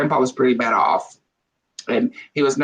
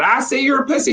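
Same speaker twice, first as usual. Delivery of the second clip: parrot voice; 10 semitones higher than usual. Acted. Parrot taunting the old woman in a put-on voice.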